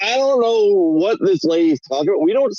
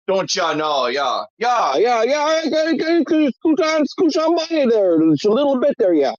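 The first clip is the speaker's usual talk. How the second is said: put-on mom's thicker Wisconsin accent; 3 semitones higher than usual. Imitating mom scooting past someone, thick Wisconsin accent. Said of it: "I can go into my mom's accent".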